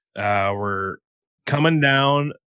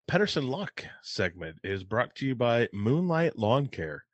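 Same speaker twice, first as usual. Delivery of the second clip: steady, quieter ad read for a lawn service; sponsor ad read; 7 dB quieter than usual. Acted. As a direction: read the sponsor spot like a radio ad. Reads sponsor ad.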